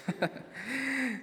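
short high laugh; a quick high chuckle; high falling giggle. Real, not acted. Laughs after Spanglish joke.

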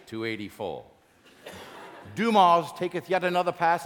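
theatrical Elizabethan sports commentary; Shakespearean play-by-play. Acted. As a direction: call the game like a Shakespeare actor. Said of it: "What would it sound like if William Shakespeare did the play-by-play".

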